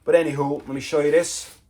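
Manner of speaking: ordinary talk, nothing marked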